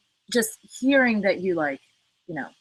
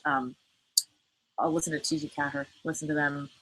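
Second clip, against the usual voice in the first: usual speaking voice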